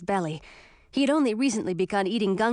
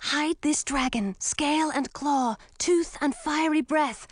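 spell incantation; 5 semitones higher than usual. A narrator's reading. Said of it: "I whispered the beginning of a spell".

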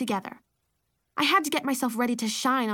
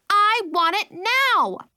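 put-on high, loud, slow toddler demand; tantrum; 10 semitones higher, 8 dB louder, much slower than usual. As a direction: throw a tantrum.

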